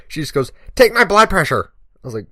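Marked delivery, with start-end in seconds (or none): 0.8-1.7 put-on loud, high-pitched demand from a pushy old woman; bossy order. Older woman interrupting the firefighter's talk to kids, bossy demand. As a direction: bark it out as a brusque command. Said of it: "just kinda ordering me".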